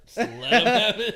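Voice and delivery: laughing. Real, not acted. Repeats line laughing, louder.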